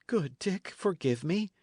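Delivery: high-pitched plea for forgiveness; pleading cry. A narrator's reading. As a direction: beg a friend not to be hurt. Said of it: "cried the other".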